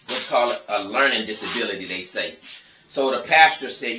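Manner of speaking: ordinary talk, nothing marked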